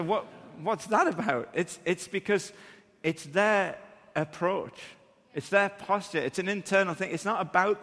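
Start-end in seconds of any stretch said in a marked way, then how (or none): none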